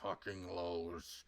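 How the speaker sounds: deep, slow mock-ominous drag; ominous low voice. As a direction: drop into a deep doom voice for the lows. Mock-dramatic about the low points.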